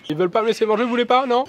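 high coaxing voice for the birds; talking to birds. Real, not acted.

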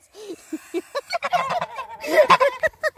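squealing giggle; high squealing laugh. Real, not acted. Goats nibbling her hand, she squeals with laughter.

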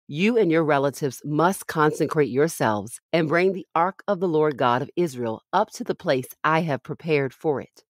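normal talking voice

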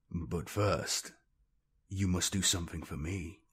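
low, hushed tyrant's command; deepened voice. A narrator's reading. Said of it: "Blackheart's voice deepened as he continued".

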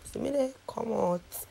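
lips held apart by retractor; talking with mouth stretched open; speaking with cheek retractor in. Real, not acted.

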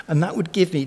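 ordinary talk, nothing marked

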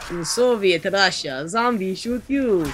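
put-on slow, high, hammy Russian accent; over-the-top Soviet Russian accent. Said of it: "Can you do a decent Russian accent?".

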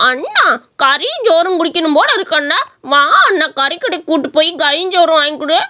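sing-song begging with pitch swoops; whiny little-brother voice; wheedling whine. Acted. Youngster wheedles brother for food.

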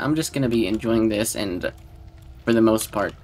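ordinary talk

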